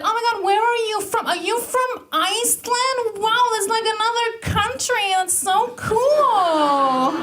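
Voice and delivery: freaking out with high-pitched, gushing amazement; Amber. Retold or imitated. Amber spotting a foreigner's accent, hammed-up high excitement. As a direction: squeal it fast, then stretch out the ending. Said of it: "freaks out".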